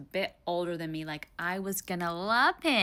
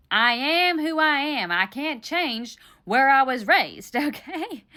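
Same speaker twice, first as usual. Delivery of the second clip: loud, higher, drawn-out protest; defiant; 5 semitones higher, 6 dB louder, slower than usual. Real, not acted. Woman defending where she was raised, defiant. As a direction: stand your ground, loud and emphatic. Defending her accent against the date.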